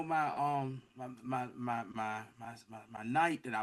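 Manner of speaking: soft, low, repetitive stumbling; hesitant stammer. Retold or imitated. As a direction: imitate someone groping quietly for words. Retold knight pleading to be remembered.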